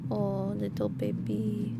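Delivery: soft, cooing endearments; tender pet-talk. Real, not acted. Streamer cuddling the pet, soft and loving. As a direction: murmur lovingly to a little pet.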